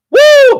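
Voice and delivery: loud high whoop. Real, not acted. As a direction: let out a triumphant woo. Celebrating her own point.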